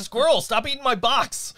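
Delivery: high, loud yell at the cat; re-enacted shout. Host re-enacts yelling at cat chewing the box, loud shout. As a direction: re-enact your own exasperated shout. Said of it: "so [name] just shouts".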